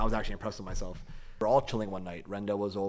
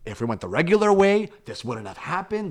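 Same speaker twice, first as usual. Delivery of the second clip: exasperated; 6 semitones higher, 7 dB louder than usual. Retold or imitated. Complaining to friend about GPS route.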